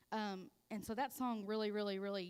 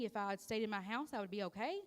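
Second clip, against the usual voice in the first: normal talking voice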